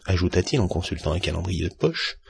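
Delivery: ordinary delivery, nothing marked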